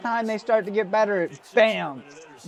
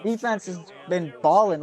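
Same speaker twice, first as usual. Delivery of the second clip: usual speaking voice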